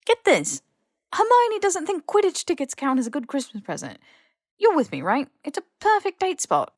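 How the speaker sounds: high, eager appeal. A narrator's reading. Ron pleading for Harry's support, high and eager. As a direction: win Harry over excitedly. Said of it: "eager for support".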